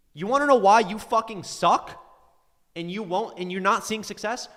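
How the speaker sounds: real frustrated tirade; exasperated rant. Real, not acted. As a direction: lay into them, building to a loud finish. Scolding viewers in a rant.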